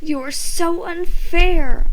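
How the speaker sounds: high, loud, slow drawn-out whine; whiny wail. A narrator's reading. Ricky complains to Tasha, childish wail. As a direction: wail it like a sulking kid. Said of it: "I wailed".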